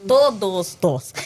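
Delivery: "Todos nós" stretched out slowly, each word weighted; slow, deliberate stress on "Todos nós"; emphatic TODOS NÓS. Real, not acted. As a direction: drive home "Todos nós" slowly and firmly. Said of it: "deixa bem claro".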